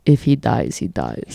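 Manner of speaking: very low, put-on Drago drone; mock Russian heavy menace; Drago impression. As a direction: drop deep and cold. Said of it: "you just see Drago".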